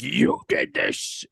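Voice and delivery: slow, high mock accusation; hammed-up high accusing wife; accusing Wendy. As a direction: drag it out as the horrified mother.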